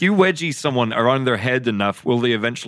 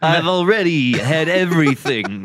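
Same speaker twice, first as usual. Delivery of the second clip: put-on nerdy kid voice; 5 semitones higher, slower than usual. Voicing bullied kid.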